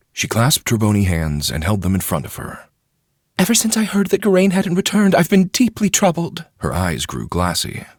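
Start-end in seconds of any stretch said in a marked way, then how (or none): 3.4-6.5 much higher, louder fretful lady's voice; a court lady pouring out her worry; troubled noble lady. A narrator's reading. Wheeler worried.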